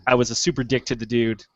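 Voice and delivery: normal talking voice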